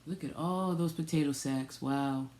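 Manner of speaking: usual speaking voice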